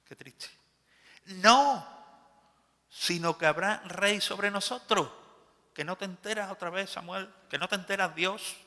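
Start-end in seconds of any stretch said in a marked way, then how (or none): 1.3-5.1 loud high defiant shout; stubborn demand. Acted. The people demanding a king, loud stubborn shout. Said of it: "Y dijo". 5.7-8.6 mocking defiance. Mocking the people's attitude.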